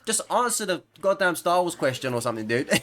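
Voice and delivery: high-pitched, hammed-up complaint; mock exasperation. Gamer mocking nitpicking comments, high exasperated voice. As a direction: raise it high in playful exasperation. Mocks commenter, then snaps back at them.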